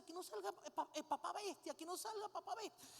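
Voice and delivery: put-on high-pitched child's plea, repeated urgently; pleading little girl. Girl begging papá not to become a beast, pleading. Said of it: "la niña comienza".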